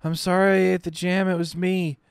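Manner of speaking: put-on high little-girl voice, pleading through fever; a delirious child begging her mother's forgiveness; feverish little girl. Dying girl apologizing to Mommy, high pleading child voice.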